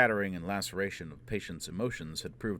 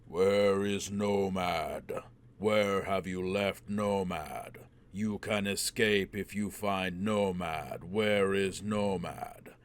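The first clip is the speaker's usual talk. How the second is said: slow, louder, booming from far off; distant booming voice; 4 dB louder, much slower than usual. Acted. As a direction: boom it slowly like a voice from the void. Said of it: "a distant voice boomed".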